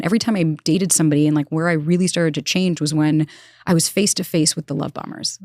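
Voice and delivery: ordinary delivery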